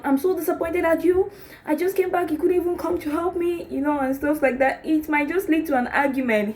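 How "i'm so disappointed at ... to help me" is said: high, sharp, accusing complaint; wife snapping at husband for not helping in the kitchen; harsh complaint. Acted. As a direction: snap at your partner, pitched way up. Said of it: "in a harsh tone".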